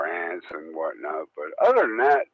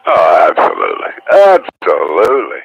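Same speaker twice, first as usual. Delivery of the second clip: shouting it out eagerly; big booming yes, absolutely; loud emphatic agreement; 12 dB louder than usual. Real, not acted. Eager radio reply, shouted agreement.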